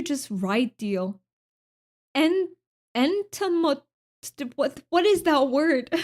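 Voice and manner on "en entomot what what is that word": baffled. Real, not acted. Stumbling over a hard chat word.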